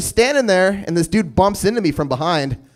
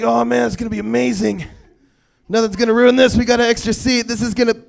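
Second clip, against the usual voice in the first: hammed-up giddy passenger, higher; a passenger gushing about the empty seat, higher; mock excited passengers; 5 semitones higher than usual.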